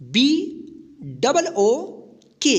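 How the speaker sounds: normal talking voice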